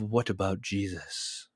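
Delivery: imitating a snarky retort; snarky Oprah response. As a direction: throw it back dismissively, dropped low. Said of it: "Oprah's made the snarky response".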